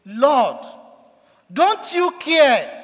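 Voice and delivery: loud, high and slow reproach; accusing cry. Retold or imitated. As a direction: drag it out as a bitter accusation. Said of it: "He accused Jesus Christ".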